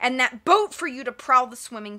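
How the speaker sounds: loud, scolding villain; gruff villain. A narrator's reading. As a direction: bark orders at the brat. Villain berates child.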